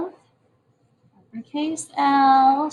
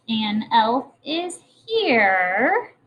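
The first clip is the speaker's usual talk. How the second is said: very slow, word by word; slow reading aloud; much slower than usual. Shown as a demonstration. Teacher reading the sentence slowly to her class. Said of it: "So far, it says".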